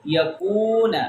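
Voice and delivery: یکون with the oo held long; long vowel. Shown as a demonstration. Teacher drills wao madda, یکون drawn out. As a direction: stretch the middle of یکون. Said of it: "کاف کو break کر کے لمبا".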